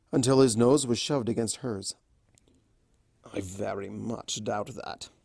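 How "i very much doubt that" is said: low, slow, softer purr; slow and silky, close to her face; deep and throaty. A narrator's reading. Discord teasing Fluttershy nose to nose, deep and throaty. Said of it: "His voice was deep and throaty".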